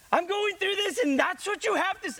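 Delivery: mock an upset kid answering a parent; sarcastic teen. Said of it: "they're sarcastic and you're".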